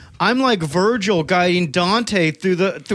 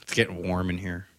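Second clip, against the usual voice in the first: much lower, much softer nervous murmur; mock nervous murmur; 11 dB quieter than usual. Reacting to Dalton, a low soft mock nervous murmur. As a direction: mutter it as if sweating.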